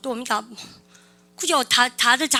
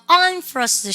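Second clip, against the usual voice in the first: I'M FRUSTRATED higher-pitched, falling at the end; copied native intonation; 5 semitones higher, 4 dB louder, much slower than usual. Shown as a demonstration. Teacher has students copy the recorded man's intonation, copied native intonation. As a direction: say "I'm frustrated" raised in pitch, copying a native speaker's tune. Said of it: "아저씨 인토네이션까지 똑같이".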